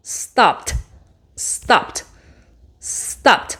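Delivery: "Stopped" whispered, ending in a crisp t; voiceless ending. Shown as a demonstration. Teacher demonstrates voiceless ed in "Stopped". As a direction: breathe out "Stopped" with a final t, no voice.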